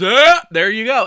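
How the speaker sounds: loud, high cry of discovery; triumphant gotcha. Retold or imitated. Wife catching husband's habit, loud gotcha.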